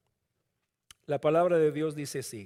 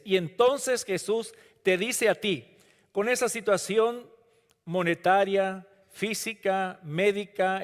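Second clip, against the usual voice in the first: ordinary delivery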